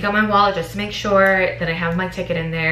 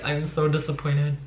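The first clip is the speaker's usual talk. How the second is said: soft, low, deflated; low, soft, stressed-out voice; disappointed; 3 semitones lower, 7 dB quieter than usual. Retold or imitated. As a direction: let the disappointment sink your voice, softer and lower. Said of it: "but I was just like".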